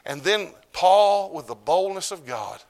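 high, loud and stretched out; slow loud preaching. Acted. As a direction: proclaim it slowly with power. Preaching climax on Paul.

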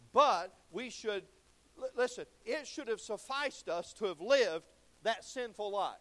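preaching. Acted. Preaching loud.

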